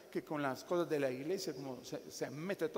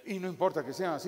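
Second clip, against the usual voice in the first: ordinary talk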